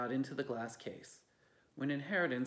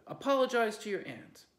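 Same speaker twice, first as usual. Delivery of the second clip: stern order; 8 semitones higher, 7 dB louder than usual. Acted.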